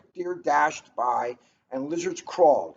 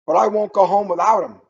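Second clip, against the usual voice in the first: loud desperate wail; 4 semitones higher, 9 dB louder, faster than usual. Acted. Father calls after the departing monkeys, loud wail. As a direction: plead it as they leave. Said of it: "wailed the man".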